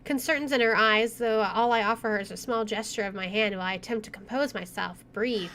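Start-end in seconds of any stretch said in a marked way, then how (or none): none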